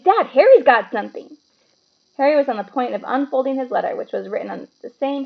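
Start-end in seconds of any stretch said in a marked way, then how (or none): 0.0-1.4 put-on higher, louder tattling boy; a spoilt boy snitching to dad; Dudley telling tales. A narrator's reading. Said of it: "said Dudley suddenly".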